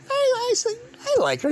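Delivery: put-on very high, childlike squeak; kiddish. Admitting to a friend he likes her, in a high childish squeak. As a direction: play a bashful kid admitting a crush. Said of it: "I was being kiddish".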